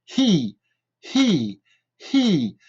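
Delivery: "He" with a big audible breath before the vowel; very aspirated H. Shown as a demonstration. Teacher drilling the H, strongly aspirated "He". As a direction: puff each "He" out separately, falling tune. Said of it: "un H très aspiré".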